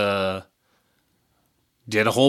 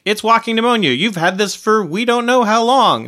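raised, louder mock doctor; 12 semitones higher, 7 dB louder than usual. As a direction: voice the doctor brightly. Doctor voiced in retold story.

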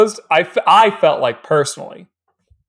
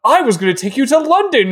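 mock outburst, higher and fast; self-important rage; 6 semitones higher, faster than usual. Podcaster mimicking Hardin's outburst at Tessa, mock indignation. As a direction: imitate a huffy, self-important rant. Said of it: "self-important rage".

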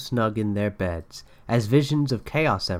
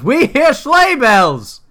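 much higher, loud and slow, the chant at full cry; happy shout at the peak; 13 semitones higher, 10 dB louder, slower than usual. Acted. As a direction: belt the last one out. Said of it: "Tikal's lively, happy voices echoed".

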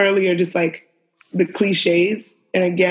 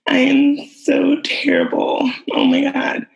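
much higher, slow, overdone self-pity; melodramatic parent; 7 semitones higher, slower than usual. Retold or imitated. Sitcom parents wailing over their own failures, high and hammed-up. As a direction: ham up the guilty sitcom parent. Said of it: "the parents would just be like".

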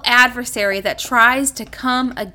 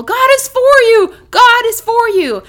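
higher, louder cheerful reassurance to someone else; encouraging others; 9 semitones higher, 6 dB louder than usual. Retold or imitated. Person cheering someone else on, higher and louder. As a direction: cheer a friend on brightly. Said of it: "easy for us to tell other people".

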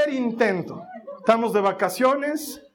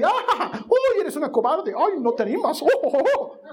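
high teasing jeer with mock laughter; mocking sister; 5 semitones higher than usual. Imitating sister teasing the scared one, mocking. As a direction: taunt like a gloating kid. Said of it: "la María Joaquina se entera y".

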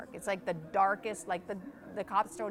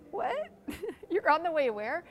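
high, loud incredulous question; acted astonishment; 7 semitones higher, 5 dB louder than usual. Retelling reaction to church president's Vatican news, high-pitched incredulity. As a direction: replay your disbelief, voice jumping way up.

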